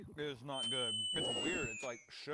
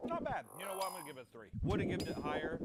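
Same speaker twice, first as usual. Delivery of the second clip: ordinary talk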